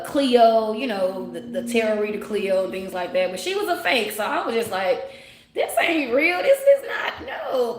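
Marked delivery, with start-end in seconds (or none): 5.5-7.8 scoffing dismissal. Retold or imitated. Dismissing a fake psychic.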